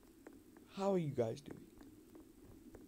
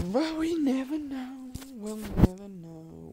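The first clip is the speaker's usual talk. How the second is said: much higher and louder, sung out dramatically; mock tragic lament; 11 semitones higher, 7 dB louder than usual. Gamer mourning the lost round in a louder, much higher mock lament. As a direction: lament it like a stage tragedy. Theatrical lament after the loss.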